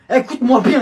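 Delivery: high, loud warning; loud comic threat; sudden shout. Acted. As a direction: burst out again. Threat after calming down.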